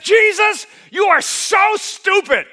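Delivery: put-on high, loud, slow childish shout upward; childish yell at God. Boy shouting his first prayer at Jesus, loud and slow. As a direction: yell it at heaven like a furious ten-year-old.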